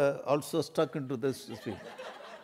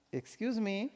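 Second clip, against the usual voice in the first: timid husband; 5 semitones higher, 6 dB quieter, slower than usual. Acted. Husband interrupts wife cooking.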